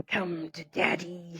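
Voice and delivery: slow, soft, drawn-out taunt; demon girl. Retold or imitated. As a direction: stretch it out like a creepy little demon. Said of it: "this little bitch is going".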